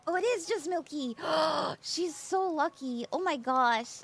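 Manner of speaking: ordinary talk, nothing marked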